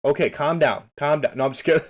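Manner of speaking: ordinary talk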